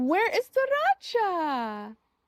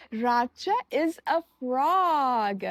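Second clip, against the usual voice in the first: ordinary delivery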